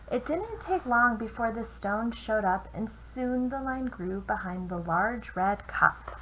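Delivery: put-on lively read-aloud for children; storyteller voice for kids; picture book reading. A narrator's reading. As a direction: read it like bedtime rhymes. Reads a rhyming picture book.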